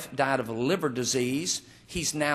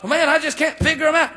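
high, loud, fast mock complaint; baffled man; 10 semitones higher, 5 dB louder, faster than usual. Man giving up on understanding women, mock exasperated. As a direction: throw up a comic cry of male bafflement. Acts baffled man's thought.

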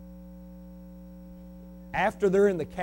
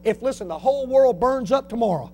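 ordinary talk, nothing marked

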